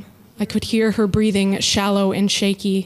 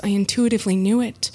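plain reading voice

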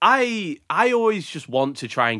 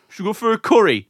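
mock suggestion; 6 semitones higher, faster than usual. Mocking mate's curry idea.

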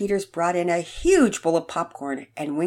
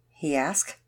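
usual speaking voice

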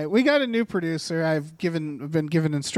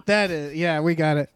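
ordinary delivery

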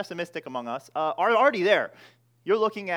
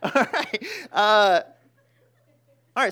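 giggling, higher and louder; laughing; 5 semitones higher, 9 dB louder than usual. Real, not acted. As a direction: laugh through the words, a bit flustered. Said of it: "Sorry, it's hot in here".

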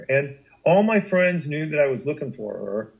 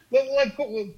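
sharp, pitched-up irritated retort; mild retold snap; 9 semitones higher, faster than usual. Snapping at her friend on the phone, irritated. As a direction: snap back in exasperation, half-imitating. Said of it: "I snapped at her".

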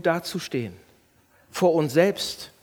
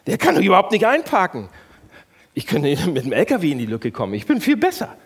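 scornful complaint; 6 dB louder, faster than usual. Shown as a demonstration. Pastor acting out judging another driver. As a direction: sneer at the bad driver. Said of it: "Kennt ihr das?".

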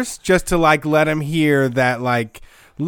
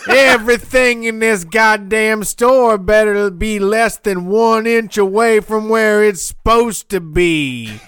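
loud barking warden voice; prison guard; 7 semitones higher, 5 dB louder than usual. Pretend. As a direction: bark orders like a jail warden. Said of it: "Sounds like a fucking warden".

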